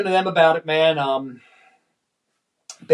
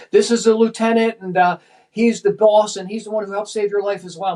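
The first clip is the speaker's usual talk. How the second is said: retold introduction in a raised pitch; mildly hammed-up, higher-voiced proud introduction; eager introduction; 5 semitones higher than usual. Firefighter introducing the lieutenant to a rescued girl, eager.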